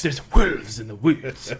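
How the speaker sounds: slow, loud, badly faked British accent; Keanu Reeves. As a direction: drag out a hammed-up British accent. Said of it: "Keanu Reeves is really good at it".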